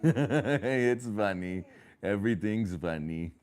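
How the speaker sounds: amused words between giggles; laughing. Real, not acted. Parent laughing along with a giggling child, amused.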